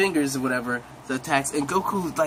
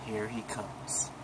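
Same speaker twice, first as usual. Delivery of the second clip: slow, hushed certainty; quiet and calm; 6 semitones lower, 13 dB quieter, much slower than usual. Retold or imitated. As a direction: say it low and slow, knowingly. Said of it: "Beerus says".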